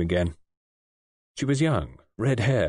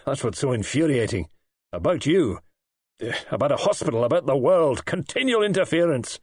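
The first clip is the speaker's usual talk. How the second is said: infuriated; 5 semitones higher than usual. A narrator's reading. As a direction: rail with mounting force. Patient rails at nurse and world.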